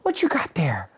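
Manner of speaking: high, whispery mom voice; mom peering at dog. Retold or imitated. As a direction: whisper the question as imitated mom. Mom questions dog more closely.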